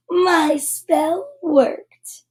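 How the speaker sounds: slow, drawn-out gloating witch; cackling witch. Acted. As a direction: savour every word like a wicked witch. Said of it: "she cackled".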